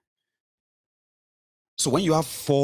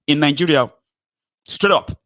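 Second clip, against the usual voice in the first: louder and much higher, flaring up; outraged outburst; 6 semitones higher, 5 dB louder than usual. Real, not acted. Speaker denouncing killings in Nigeria, an outraged higher shout. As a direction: let it burst out in indignation. Outraged climax of political rant.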